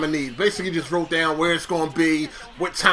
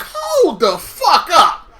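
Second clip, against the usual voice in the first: slow, drawn-out high disbelief; incredulous; 8 semitones higher, 4 dB louder, much slower than usual. Retold or imitated. Job seeker spotting the manager, slow high incredulity. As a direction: stretch it out like you caught them. Inner reaction seeing manager interview.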